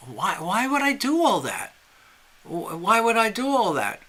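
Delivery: puzzled, hesitant start, then a lilting question; baffled questioning. Acted. Old fisherman answering the pushy stranger, baffled.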